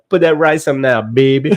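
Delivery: mock Cajun chef; Cajun chef impression. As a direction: croon it like a Louisiana cook. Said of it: "he's like".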